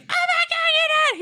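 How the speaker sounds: very high mock shriek; hammed-up high-pitched fright; screaming panic. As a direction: squeal like panicking dancers. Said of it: "They all start screaming".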